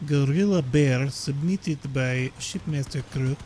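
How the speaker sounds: slow, hammed-up Russian accent; put-on Russian accent, drawn out; terrible Russian accent. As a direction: announce it slowly in a bad Russian accent. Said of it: "doing a terrible Russian accent".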